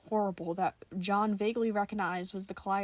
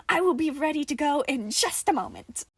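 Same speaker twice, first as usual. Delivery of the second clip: jolly Nicola voice; 6 semitones higher, 6 dB louder than usual. A narrator's reading.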